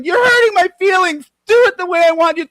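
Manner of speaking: loud, high-pitched crybaby wail; mock crying. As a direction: imitate a tantrum, complaining at full volume. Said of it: "Crying is when you're going".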